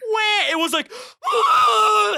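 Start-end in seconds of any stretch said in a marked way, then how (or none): 0.1-0.5 short mock wail; brief, high kiddie wah; ordinary cry. Shown as a demonstration. Storyteller showing what the scream was not, short mock cry. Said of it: "the most ungodly scream you've ever heard. It was not". 1.3-2.1 long, piercing high squeal; ungodly scream. Retold or imitated. Storyteller imitating the hit kid, ungodly long scream. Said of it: "the most ungodly scream you've ever heard".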